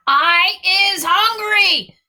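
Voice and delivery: high, loud, slow giant boom; hungry giant booming at a little girl. A narrator's reading. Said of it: "the giant boomed".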